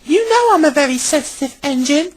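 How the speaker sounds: huffy, high-pitched protest; Molly. A narrator's reading. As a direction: complain touchily in a high character voice. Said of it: "huffed Molly".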